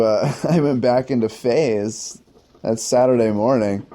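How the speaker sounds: normal talking voice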